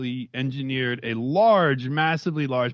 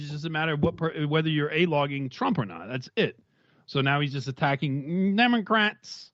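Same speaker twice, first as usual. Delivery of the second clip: ordinary talk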